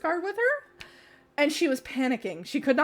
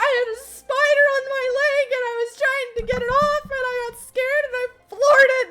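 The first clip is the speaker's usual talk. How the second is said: high, breathless excuse; high-pitched flustered explanation; frantic woman explaining; 12 semitones higher, 4 dB louder than usual. Retold or imitated. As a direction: gush the spider story in her voice. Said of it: "She's like".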